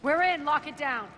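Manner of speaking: loud, high, breathless command; urgent shout. Acted. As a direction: shout it as the door closes. Urgent shout after getting inside.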